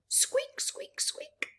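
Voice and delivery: tiny high squeaky mouse voice; high mousey squeaks. Acted. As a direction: squeak it like a little mouse, fading to a breath.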